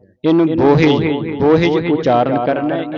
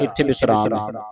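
ordinary talk, nothing marked

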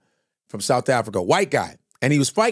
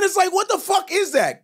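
exasperated rant. Real, not acted. Comic ranting at the audience, shouting. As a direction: throw it at the crowd in disbelief.

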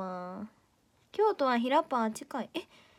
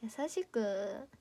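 slow echo; 7 dB quieter, much slower than usual. Shown as a demonstration.

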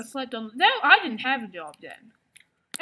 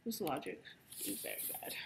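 whisper; 10 semitones lower, 18 dB quieter, faster than usual. Real, not acted. Muttered aside.

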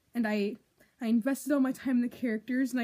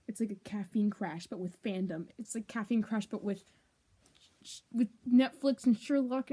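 normal talking voice

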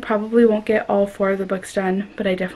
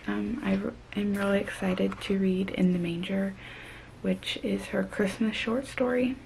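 hushed voice; 3 semitones lower, 11 dB quieter than usual. Real, not acted. Late-night hushed talk.

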